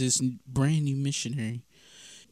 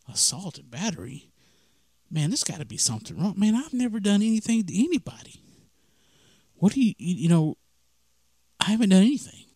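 higher pleading protest of innocence; frantic protest; 6 semitones higher, faster than usual. Retold or imitated. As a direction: push the denial up in alarm. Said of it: "continuing to freak out".

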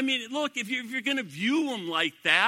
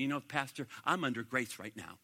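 smug churchgoer; 6 semitones lower, 7 dB quieter than usual. Retold or imitated. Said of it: "far too many people say to me".

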